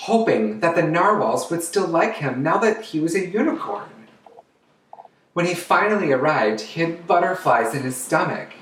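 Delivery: put-on lively storytime narration for kids; storybook reading. Drag queen reads a picture book to children, animated. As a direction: read it out like storytime for little ones.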